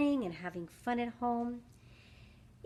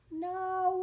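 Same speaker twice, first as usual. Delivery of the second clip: whisper; 6 semitones higher, 3 dB quieter than usual. Acted. Oven left on.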